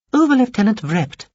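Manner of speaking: a small alien stating its rank; high alien voice. A narrator's reading. Alien introduces itself to Veppers, high and formal. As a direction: announce "Over-Lieutenant Vrept" high and loud, alien and formal. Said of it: "it told him".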